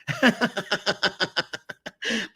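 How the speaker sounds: long high laugh. Real, not acted. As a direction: laugh at the absurd story. Laughing at own story.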